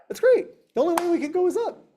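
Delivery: mock-delighted praise for a zero; excited cheer. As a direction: cheer it like great news. Encouraging a zero income.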